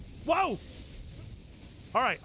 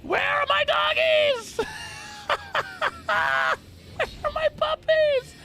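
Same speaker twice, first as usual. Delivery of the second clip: much higher, louder, drawn-out mock sobbing; hammed-up wail; 13 semitones higher, 5 dB louder, slower than usual. Gamer mock-mourning his lost puppy dogs, hammed-up wailing. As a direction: wail for the lost puppies like a tragedy.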